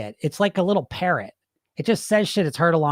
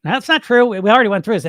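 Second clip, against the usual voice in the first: louder firm rebuttal; coach voice; 6 semitones higher, 7 dB louder than usual. Retold or imitated. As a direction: shut the doubt down. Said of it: "the coach voice comes out".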